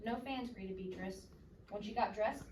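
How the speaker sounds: ordinary delivery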